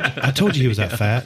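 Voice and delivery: usual speaking voice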